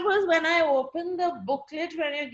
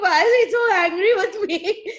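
louder, higher, laughing mock bewilderment; laughing confused question; 7 semitones higher, 7 dB louder than usual. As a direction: laugh through the puzzled question. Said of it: "I think".

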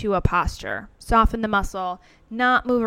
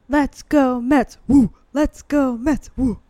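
mock stadium woo; 3 semitones higher, 6 dB louder than usual. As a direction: chant with a loud barked woo in the pause. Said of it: "some dude adds a woo, like a deep-throated, kind of guttural, aggressive bark".

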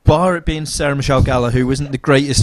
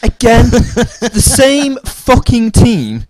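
exasperated; 10 semitones higher, 5 dB louder, much slower than usual. Real, not acted. Exasperated rant about the remake.

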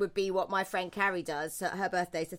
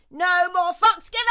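loud, much higher triumphant cry; defiant shout; 10 semitones higher, 11 dB louder than usual. Acted. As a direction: yell it like a battle cry. Defiant podcast intro slogan.

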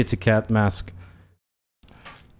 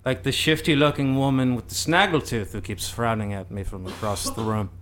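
hushed dramatic teacher voice; soft mystical acting guru; soft theatrical narration; 6 dB quieter than usual.

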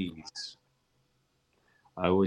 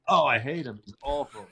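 mock disgust; 5 semitones higher, 4 dB louder than usual. Retold mock disgust at singer's name.

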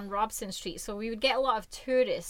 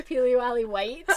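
laughing peely-wally; 4 semitones higher, 9 dB louder than usual. Shown as a demonstration. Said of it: "as we would say".

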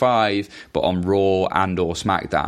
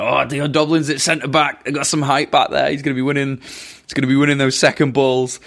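higher, lightly voiced nineties pundit, confident; a hint of a know-it-all commentator; mock pundit; 6 semitones higher than usual. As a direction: send up an old TV football pundit. Mocking nineties football pundit.

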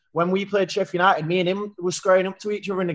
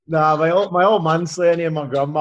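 ordinary delivery

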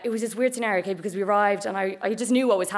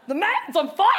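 high, loud mock yell in a put-on accent; hammed-up alarm cry; excited yell; 10 semitones higher, 5 dB louder than usual. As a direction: imitate a mate hollering the news. Max yells about the fire.

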